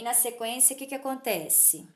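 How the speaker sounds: ordinary talk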